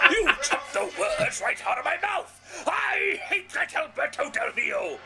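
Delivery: high, loud ranting villain voice; Zeb Colter impression. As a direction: shout it like a cartoon bad guy. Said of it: "it's my impression of Zeb Culture there".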